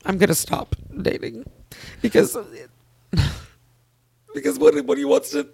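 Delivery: high, tearful, halting through real sobs; crying. Real, not acted. Woman tells friend she'll stop dating, crying. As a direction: cry through the words, pitched high. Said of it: "got the cry train moving".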